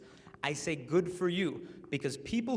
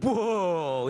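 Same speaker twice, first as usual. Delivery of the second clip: mock shock; 2 semitones higher, 7 dB louder than usual. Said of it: "Luke will be like".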